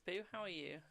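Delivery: ordinary delivery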